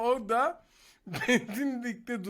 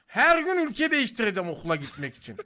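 excited; 2 semitones higher, 7 dB louder than usual. Retold or imitated. Retelling his daily border-crossing plan.

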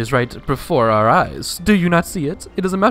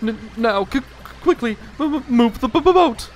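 higher, stammering with fear; scared stammer; 5 semitones higher than usual. Acted. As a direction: try to stay calm, failing.

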